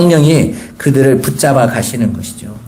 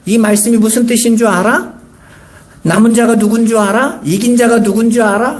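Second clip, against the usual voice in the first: higher-pitched quizzing, each question rising; mock know-it-all; 7 semitones higher than usual. Cult recruiters testing believers on scripture, mock know-it-all. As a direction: imitate someone testing others as if they know it all. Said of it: "굉장히 아는 것처럼".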